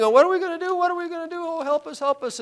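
high, pleading mock whine of a panicked crowd; whining crowd. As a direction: whimper it like scared people with an army coming. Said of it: "the people are whining".

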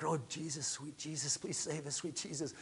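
very soft imitation of a praying woman; hushed background plea. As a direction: murmur it like someone pleading in the background. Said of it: "you hear a lady in the back".

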